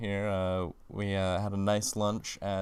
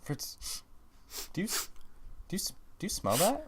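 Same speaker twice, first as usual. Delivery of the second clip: squeaky high sniffing voice; 9 semitones higher, 6 dB quieter than usual. Acted. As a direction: sniff the air in a squeaky falsetto. Sniffing out the beef segment.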